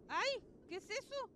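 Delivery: loud high startled cry; scared yelp. Real, not acted. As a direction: jump at the fright and yell. Jump scare in the game.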